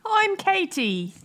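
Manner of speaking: high, drawn-out bad British accent; badly faked British introduction; terrible British accent. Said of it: "what a terrible accent".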